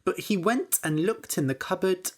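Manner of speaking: ordinary delivery, nothing marked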